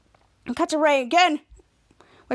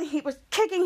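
ordinary talk

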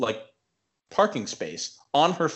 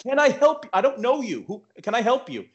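retold yell; 11 semitones higher than usual. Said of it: "I'm yelling".

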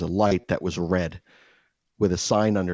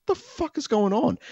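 pitched way up in disbelief; incredulous; 12 semitones higher than usual. Retold or imitated. Retelling the lifeguard clash, high incredulous squeak.

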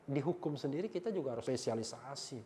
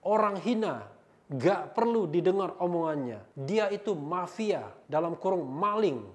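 loud, slightly raised, declaimed read-out; emphatic insult reading; 4 semitones higher, 9 dB louder than usual. Acted. Reads insulting comment aloud.

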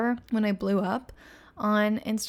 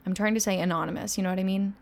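ordinary talk, nothing marked

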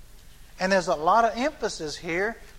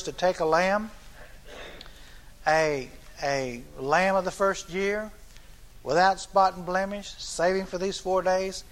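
ordinary delivery

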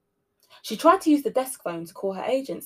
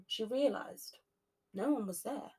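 usual speaking voice

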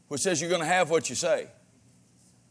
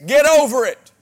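loud, high, punching the word over; forceful preaching; 7 semitones higher, 9 dB louder than usual. Real, not acted. Preacher urging his church to drop offenses, loud and forceful. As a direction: hammer it home from the pulpit.

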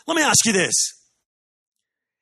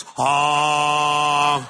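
angry car honk imitation; 3 semitones lower than usual. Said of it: "the guy behind you goes".

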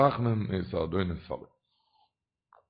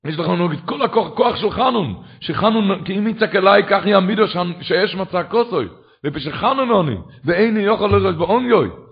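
raised, fervent sermon voice; exhorting the congregation with force; impassioned preaching; 5 semitones higher, 5 dB louder than usual. Real, not acted. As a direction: drive the verse home with loud, high-pitched conviction.

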